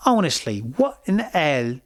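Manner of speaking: exaggerated British English, playfully indignant; British accent. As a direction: say it as a mock Brit. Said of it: "That's my British accent".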